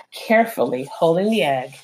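usual speaking voice

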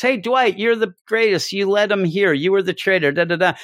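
high, sing-song fake thanks; hammed-up cheerful villagers; mock cheery praise. Mocking characters praising Dwight.

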